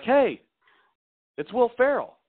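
high, bright, loud hello; cheery celebrity hello. Retold or imitated. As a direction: announce yourself like a big surprise. Will Ferrell greets friend's wife.

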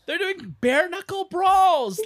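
high, slow, laughing disbelief; incredulous laughing. Real, not acted. Podcaster laughing in disbelief at the fight, high and slow.

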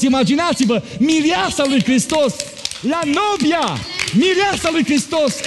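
high, rising preacher's fervour; fervent preaching. Acted. Pastor lifting the church toward Christ's coming, fervent preaching. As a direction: preach it up with mounting fire. Preacher building to full force.

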